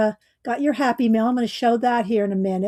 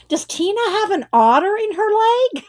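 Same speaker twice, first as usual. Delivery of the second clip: much higher, baffled half-laughing question; puzzled thought; 11 semitones higher, 4 dB louder than usual. Retold or imitated. Misreading the otter drawing, asking herself in a high, baffled voice. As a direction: voice her confused thought as a bewildered squeak. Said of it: "I'm thinking".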